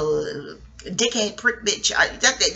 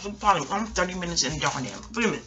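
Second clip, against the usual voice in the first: chewing while talking; mouth full; 2 semitones lower, 6 dB quieter, faster than usual. Real, not acted. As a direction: talk through a mouthful. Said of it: "pardon the chewing".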